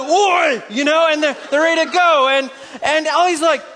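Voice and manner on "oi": raised mock-Scottish warrior shout; Scottish answering cry. Troops answering their leader, rousing Scottish shout. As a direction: answer the rallying call, Scottish accent. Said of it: "He was a Scottish guy".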